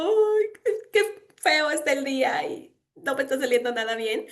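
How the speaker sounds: high, far, put-on whimper over a bad day; mock whining. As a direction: whine like a little girl having an awful day. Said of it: "cuando de repente ando como". (imitando un lloriqueo agudo y quejumbroso)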